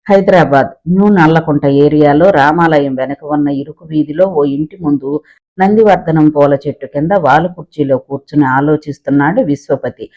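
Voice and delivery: ordinary delivery, nothing marked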